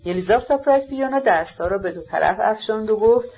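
ordinary delivery

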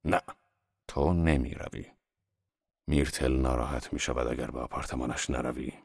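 low-pitched, firm refusal; decisive. A narrator's reading. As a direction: overrule them in a low, controlled man's voice. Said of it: "با قاطعیت گفت".

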